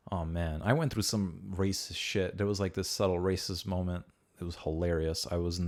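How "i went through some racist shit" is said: ordinary talk, nothing marked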